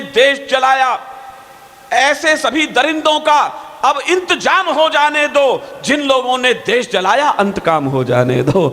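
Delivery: slow, declaimed Hindi verse; poetry recitation. Acted. Poet demanding reckoning for the cruel, declamatory recitation.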